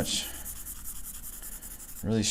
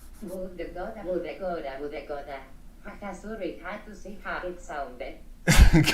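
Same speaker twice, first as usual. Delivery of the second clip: laughing; 9 semitones higher than usual. Real, not acted. Laughing at a chat message.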